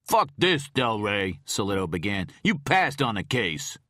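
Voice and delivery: loud, high furious accusation; detective confronting the agent; angry confrontation. Acted. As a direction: throw it in his face.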